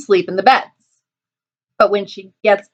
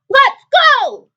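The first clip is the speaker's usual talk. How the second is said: very high honking goose cry; 4 dB louder than usual. Acted. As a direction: honk it out as a rallying call. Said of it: "honked Goosey Lucy".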